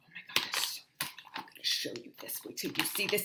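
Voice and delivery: excited whisper; hushed whisper. Real, not acted. Teacher teasing the next set, whispered. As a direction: whisper with bated breath.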